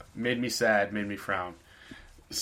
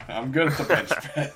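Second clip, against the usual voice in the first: raised, louder voice through laughter; laughing mock boast; 5 semitones higher, 5 dB louder than usual. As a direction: boast jokingly while cracking up. Riffing boast, cracking up.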